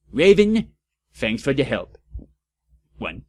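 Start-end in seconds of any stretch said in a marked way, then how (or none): 0.1-0.7 calling out. Acted. Calling friend's name.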